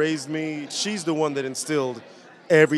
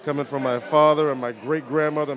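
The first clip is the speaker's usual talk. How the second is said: ordinary delivery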